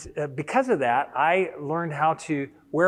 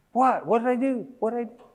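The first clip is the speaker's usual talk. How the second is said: higher, alarmed retold surprise; startled; 9 semitones higher, 3 dB louder than usual. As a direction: caught off guard, asking what went wrong. Startled reply to his wife.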